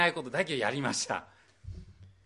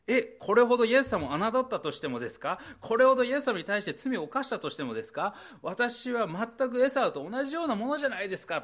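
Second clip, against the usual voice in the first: higher, louder pleading protest; anxious doubter; 7 semitones higher, 4 dB louder than usual. Acted. Preacher voicing a doubting believer, pleading louder and higher. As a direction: plead your unworthiness in disbelief. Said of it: "と思う方がおられるかもしれません".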